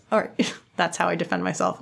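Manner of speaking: ordinary delivery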